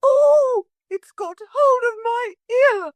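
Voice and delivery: very high pained scream. A narrator's reading. Motor lady screaming as the parrot seizes her ear. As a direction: scream as the beak grips. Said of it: "And then she screamed".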